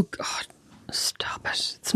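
hushed embarrassed whisper. Real, not acted. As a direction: whisper it, half-mortified. Embarrassed hushing about Christmas gifts.

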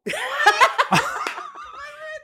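high helpless laugh. Real, not acted. Telling cohosts a friend's silly alias, high laughter. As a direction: crack up at your own story. Cracking up at own story.

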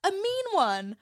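high, fretful dinosaur voice; anxious Rex. Retold or imitated. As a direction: worry like a nervous toy dinosaur. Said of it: "Rex is like".

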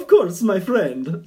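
booming welcoming merchant voice; North African accent. Retold or imitated. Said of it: "I think they would say".

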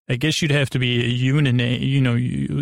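ordinary talk, nothing marked

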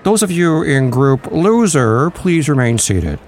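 snooty boarding call over the intercom; airport announcement. Pretend. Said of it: "I sound like Ed Sullivan".

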